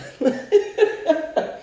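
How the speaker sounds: high laugh. Real, not acted. Laughs after big pour.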